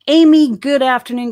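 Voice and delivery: belted-out hello into the mic; big, high shout-out; too-loud greeting. Real, not acted. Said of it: "talk too loud".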